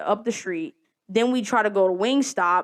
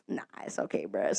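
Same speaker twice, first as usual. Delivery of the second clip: dismissive whisper; 14 dB quieter than usual. Retold or imitated. Friend brushes off the rush.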